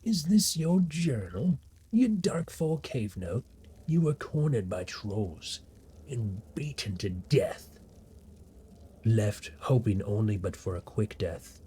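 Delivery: slow, drawn-out storyteller delivery; dramatic journal reading. Acted. Reader recounts being cornered by trolls, slow and dramatic. Reads a journal note aloud.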